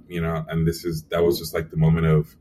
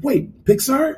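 surprised mother; slower than usual. Retold or imitated. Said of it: "She's like".

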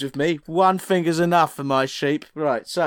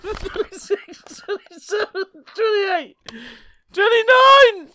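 laughing; 4 dB louder, slower than usual. Real, not acted. Counting while laughing.

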